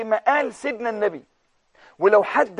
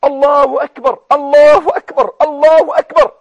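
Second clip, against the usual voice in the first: loud, higher, ringing Allahu akbar; joyful takbir; 5 semitones higher, 9 dB louder than usual. Retold or imitated. Preacher showing how the Prophet rejoiced, ringing takbir. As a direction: shout it out with joy. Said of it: "وكأن النبي فرح".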